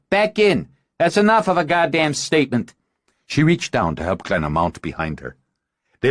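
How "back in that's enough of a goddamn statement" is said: shout. Acted. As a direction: yell them back in. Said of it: "Blair shouted".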